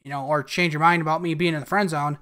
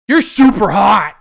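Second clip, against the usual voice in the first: loud and slow, teasing the character; playful shout; 10 dB louder, slower than usual. Real, not acted. Gamer yells at Iris on screen, loud slow teasing. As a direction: shout it out slowly like a cheeky fan.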